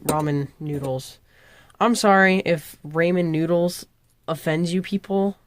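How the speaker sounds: ordinary talk, nothing marked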